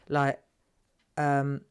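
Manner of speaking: ordinary talk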